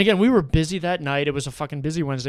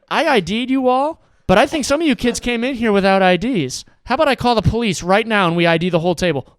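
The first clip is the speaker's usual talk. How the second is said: loud, raised-pitch challenge; retold threat; 7 semitones higher, 8 dB louder than usual. Bartender threatens to call police on the table, confrontational. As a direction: lay down the law loudly.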